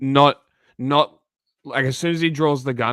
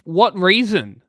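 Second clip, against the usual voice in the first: shocked disbelief; 5 semitones higher, 4 dB louder, much slower than usual. Real, not acted. Shocked reacting to shooting video.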